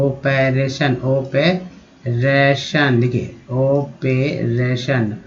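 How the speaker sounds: "Operation" spoken very slowly, syllable by syllable; slow demo. Shown as a demonstration. Teacher showing students how to read a word, very slow. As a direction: stretch out "operation" so every syllable is heard.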